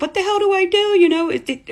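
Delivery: high, loud panicked outburst; frantic retold plea for help; freaking out. Mother on the phone with family, freaking out. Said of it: "I'm freaking out".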